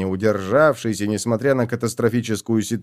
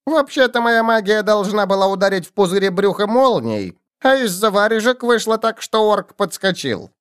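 high goblin grumble; grumbling goblin; 8 semitones higher than usual. A narrator's reading. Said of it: "недовольно нахохлился и пробурчал".

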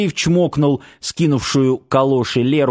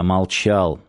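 usual speaking voice

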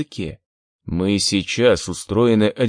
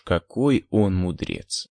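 plain reading voice

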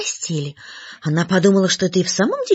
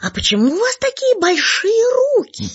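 put-on high, bright little girl voice; 3 dB louder than usual. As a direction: speak up like a small girl. Girl asks grandmother.

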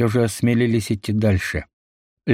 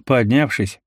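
ordinary delivery

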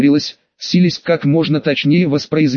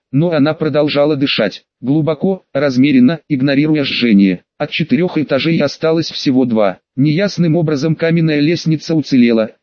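ordinary delivery

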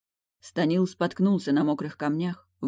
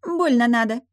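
high, loud, scornful mermaid; snorting mermaid; 8 semitones higher, 7 dB louder than usual. A narrator's reading. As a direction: scoff in a high voice. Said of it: "фыркнула девица".

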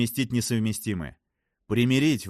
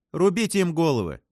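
high, louder cry; exclaiming; 8 semitones higher, 5 dB louder than usual. A narrator's reading. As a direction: shout it out like a decree, pitched up. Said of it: "воскликнула Фрэнсис". (восклицая, высоко и громче)

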